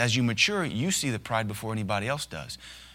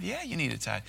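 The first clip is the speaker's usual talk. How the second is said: ordinary talk, nothing marked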